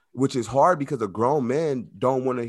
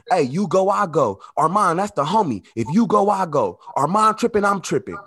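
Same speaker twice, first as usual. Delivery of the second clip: higher, louder, amped loyalty chant; hyped prison homie; 6 semitones higher, 5 dB louder than usual. Retold or imitated. Inmate hyping up his crew, higher and louder excited mock. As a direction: hype up the crew like a fired-up homie.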